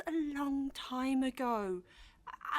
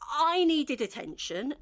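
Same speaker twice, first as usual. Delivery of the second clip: insistent; 4 semitones higher, 6 dB louder than usual. Acted. Rising insistence.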